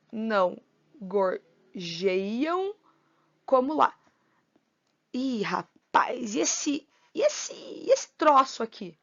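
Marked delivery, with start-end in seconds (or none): 0.1-4.0 slow, split into poetic syllables. Shown as a demonstration. Teacher scanning the verse for students, slowly syllable by syllable. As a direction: count out each syllable with pauses. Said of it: "E o não gorjeiam como lá".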